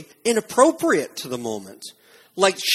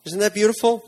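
ordinary talk, nothing marked

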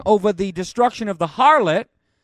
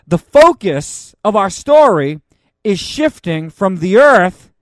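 excited preaching; 2 semitones higher, 7 dB louder than usual. Acted. Builds excitement to close.

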